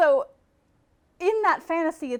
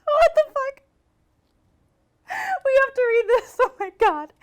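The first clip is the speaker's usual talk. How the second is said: shrieking laughter; 14 semitones higher, 8 dB louder than usual. Real, not acted. Cracking up at the ad.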